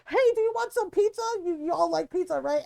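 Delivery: loud, high, rapid-fire pitch; screaming ad voice. Retold or imitated. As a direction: blast it out like an obnoxious radio ad. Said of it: "it's screaming".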